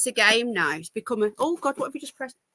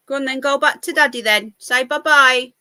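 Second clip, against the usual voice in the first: ordinary talk, nothing marked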